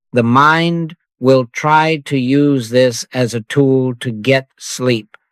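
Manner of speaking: ordinary talk, nothing marked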